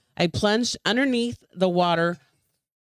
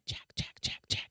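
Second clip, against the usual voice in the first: unvoiced eerie whisper of the name; ghostly whisper; 14 dB quieter than usual. A narrator's reading. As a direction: breathe the name like a spirit in the fog. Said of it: "it hissed".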